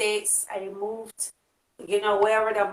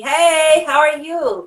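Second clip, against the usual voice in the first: excited greeting; 5 semitones higher, 6 dB louder, much slower than usual. Retold or imitated. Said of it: "a lot of people have been telling me".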